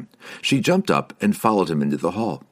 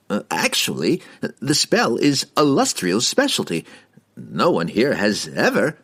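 flustered hesitation; 4 semitones higher than usual. A narrator's reading. Flustered wizard facing impatient dwarf.

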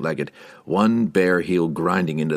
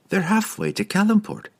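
light higher woman's voice; young woman voice. Acted. Catti-brie voiced in story.

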